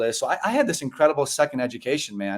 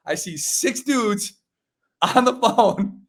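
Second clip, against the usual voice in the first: amused disbelief; 9 semitones higher, slower than usual. Retold or imitated. He retells arriving at a tiny office.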